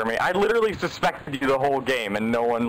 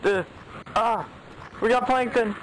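excited callout; 10 semitones higher than usual. Real, not acted.

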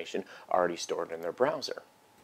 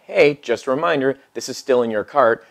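louder, chirpy reminder voice; friendly reminder; 5 semitones higher, 11 dB louder than usual. Pretend. Store email nudging a distracted shopper back, chirpy and louder. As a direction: sell it like a cheerful nudge from the shop.

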